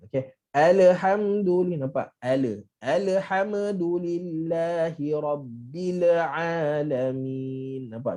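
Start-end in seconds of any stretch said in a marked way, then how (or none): 2.8-7.9 slow chanted "Alhamdulillahi rabbil alamin" with every letter bouncing; bouncing recitation. Shown as a demonstration. Teacher demonstrates the wrong bouncing recitation. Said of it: "Ni semua melantun".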